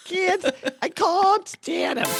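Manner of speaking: very high, drawn-out diva caricature with "can't" as "cahn't"; laughing, hammed-up elocution attempt; Lena Lamont. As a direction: stretch it into an overdone "cahn't". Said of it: "It's like Lena Lamont".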